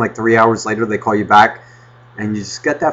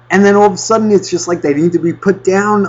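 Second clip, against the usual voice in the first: ordinary talk, nothing marked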